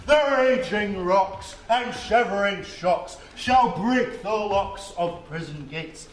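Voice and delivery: slow, loud bombastic rant; ranting tyrant. Acted. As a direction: roar it like a ham tyrant. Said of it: "to make all split".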